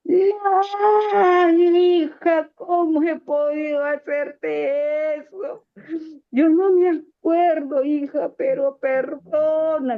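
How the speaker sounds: imitating the father's weeping, high, loud plea, slowed right down; crying shout. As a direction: voice the sobbing father crying out for forgiveness. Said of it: "se levantó de la silla gritando".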